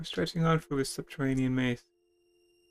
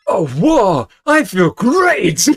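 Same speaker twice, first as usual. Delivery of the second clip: loud, high, slow whoa of relief; cartoonish joy; hammed-up delight; 7 semitones higher, 13 dB louder, much slower than usual. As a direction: overplay feeling the potion kick in. Hero drinks potion.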